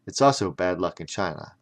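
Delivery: ordinary delivery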